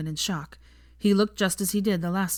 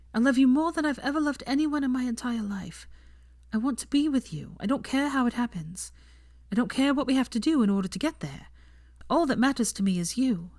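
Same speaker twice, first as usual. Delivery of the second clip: higher voice, sincere and tender; heartfelt confession; 7 semitones higher than usual. Acted. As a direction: pour your heart out to him.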